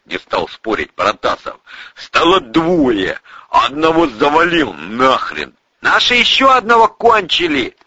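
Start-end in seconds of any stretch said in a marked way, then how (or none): 2.0-5.5 raised-pitch hollering; brash shouted report; shouted boast. Acted. 5.8-7.8 loud, high holler; high sentry's yell; calling out news. Acted. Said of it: "сообщили из-за баррикады".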